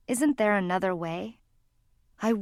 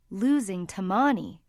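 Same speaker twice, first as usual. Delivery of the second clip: usual speaking voice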